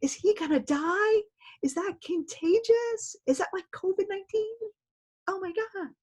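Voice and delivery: high, hammed-up panicked student voice; mock panic. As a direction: play a student freaking out over a strange word. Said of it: "So now I'm like".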